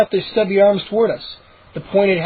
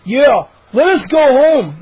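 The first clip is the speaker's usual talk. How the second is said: higher, louder, slow insistence; insistent; 6 semitones higher, 6 dB louder, slower than usual. Acted. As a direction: press the demand, firmly. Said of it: "Moose insisted".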